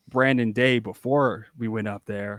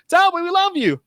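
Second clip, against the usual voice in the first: adoring fan in a squeaky high voice; a fan gushing in falsetto; 6 dB louder than usual. Retold or imitated. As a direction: swoon it way up high. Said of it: "or, like".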